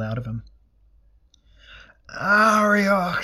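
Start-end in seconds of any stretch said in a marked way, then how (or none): 2.1-3.2 high, loud drawn-out scream; screamed plea. A narrator's reading. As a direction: scream desperately for a patron's help.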